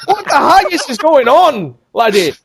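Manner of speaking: high, hammed-up Scottish accent; mock Scottish outburst. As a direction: exclaim it like a comic Scot. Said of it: "that popular Scottish phrase".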